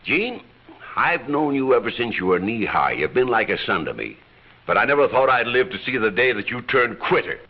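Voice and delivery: normal talking voice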